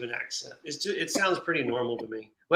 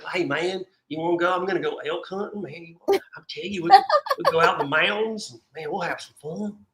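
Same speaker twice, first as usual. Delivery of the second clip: put-on hillbilly accent; 3 semitones higher than usual. Niece's husband inviting you elk hunting, mock hillbilly accent. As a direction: imitate a Tennessee hillbilly accent, loosely. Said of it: "That's the hillbilly accent".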